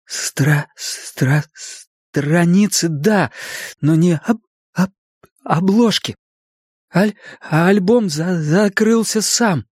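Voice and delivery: high, laboured stammer with pauses; stuttering. A narrator's reading. Bill insists to Richie the album closed itself, stuttering. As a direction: repeat broken syllables, drag the words out.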